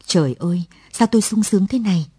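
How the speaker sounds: ordinary delivery, nothing marked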